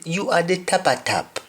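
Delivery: "tapa tap" sung out high, tah-pa-tap; emphatic Patois "tapa tap". Shown as a demonstration. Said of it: "you're the head of the family".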